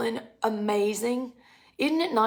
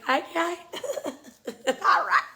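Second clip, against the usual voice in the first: laughing; 8 semitones higher than usual. Retold or imitated. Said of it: "I'm like".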